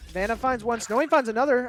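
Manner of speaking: animated caster burst; excited play-by-play. Real, not acted. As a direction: call two quick kills, building. Live call of two quick kills.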